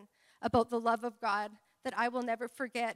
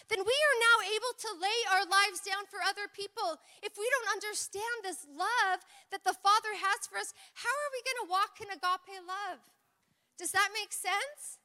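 usual speaking voice

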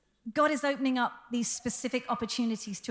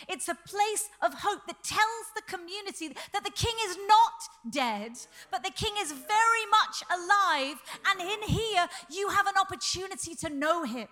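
rising preaching fervour; 6 semitones higher than usual. Real, not acted. Preaching the living king.

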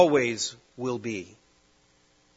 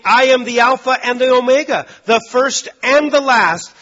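proclaiming Jesus; 9 semitones higher, 8 dB louder than usual. Acted. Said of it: "records Jesus saying".

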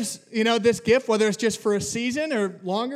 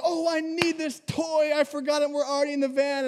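a child's whine, lightly mocked; whiny kid voice; 5 semitones higher than usual. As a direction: play the kid who forgot his toy. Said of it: "it's like".

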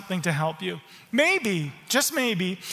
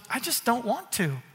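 imitating dad; 4 dB quieter than usual. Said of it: "Dad goes".

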